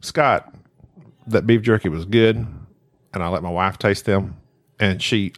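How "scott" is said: normal talking voice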